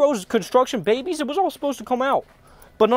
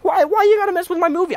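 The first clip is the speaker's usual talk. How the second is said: higher, pleading and fed up; high-pitched outrage over his movie; exasperated outburst; 8 semitones higher than usual. Retold or imitated. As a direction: throw it at them like a wounded artist. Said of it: "I'm like".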